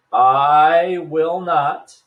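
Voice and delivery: "not" drawn out slowly and loud; stress on not. Shown as a demonstration. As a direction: lean hard on "not", very slowly. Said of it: "and emphasize not".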